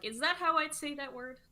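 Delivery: a playful doubting question to yourself; mock self-check. Shown as a demonstration.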